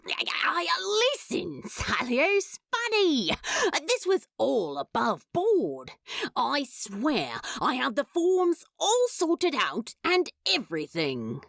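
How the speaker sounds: a lightly voiced, flustered demon rushing to explain; nervous Crowley. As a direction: babble it out, half-panicked, trying to sound confident. Crowley pleads, nervous and manic.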